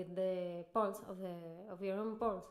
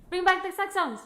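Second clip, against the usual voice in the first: much louder and higher rallying call; rally the room for the Saxons; mock outraged cry; 7 semitones higher, 11 dB louder than usual. Real, not acted. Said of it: "mock outrage rant".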